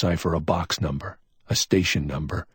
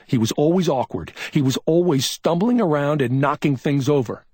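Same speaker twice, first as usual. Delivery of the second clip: higher, curt and forceful; brusque; 9 semitones higher, 4 dB louder than usual. A narrator's reading. McMain reconstructs what Tommy did, brusque. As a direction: lay it out briskly. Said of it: "McMain's voice was brusque".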